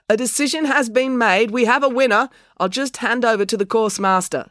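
high, projected announcer's delivery. A narrator's reading. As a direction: announce it grandly to regain some dignity. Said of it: "using his announcer's voice again".

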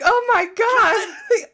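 high, slow, laughing exclamation; retold delighted gasp; laughing oh my god. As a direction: squeal it while laughing. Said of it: "and I'm like".

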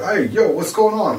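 high, loud cartoon Jersey accent; cartoonish Jersey accent. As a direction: ham up a cartoon New Jersey guy. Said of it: "I'd do the cartoonish one that everybody does".

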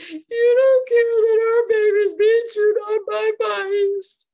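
high, loud, slow anguished cry of accusation; a frantic mother's slow, high wail at her husband; distraught outburst. Retold or imitated. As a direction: hurl it at him, beside yourself with fear.

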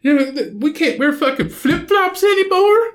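high, loud whiny mock voice; whiny southerner. Mocking southerners who fear snow, high whiny voice. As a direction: mimic wimpy southerners fussing about snow. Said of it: "everybody's like".

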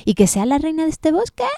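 high, fast scoffing question; exclaimed. Acted. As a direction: scoff at being queen. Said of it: "exclamó".

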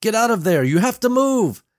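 high, loud, desperate yell; urgent warning shout. Acted. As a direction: holler to get someone out of danger. Father shouts warning to man outside.

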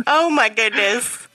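ordinary delivery

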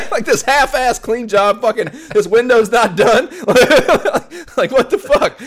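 high, loud, laughing gripe; teasing a sloppy co-op partner, laughing mock anger; laughing mock complaint. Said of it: "bitching at each other".

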